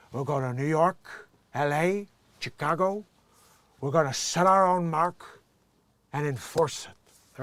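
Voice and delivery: put-on slow, loud Cuban gangster accent; Scarface voice. Gangster announcing his turf in a slow Cuban accent. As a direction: lay down the law like a Cuban mob boss. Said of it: "bring a Scarface reference back".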